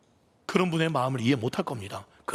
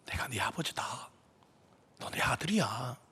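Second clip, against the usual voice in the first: softer, breathy hushed whisper; whispered voice of God; 3 semitones lower, 7 dB quieter than usual. Retold or imitated. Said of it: "세미한 음성으로". (하나님이 새벽 화장실에서, 속삭이는 음성으로)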